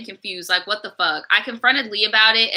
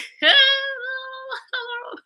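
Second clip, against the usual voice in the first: squealing laugh pitched way up; high squealing laugh; 3 dB quieter than usual. Real, not acted. Laughs at the boyfriend.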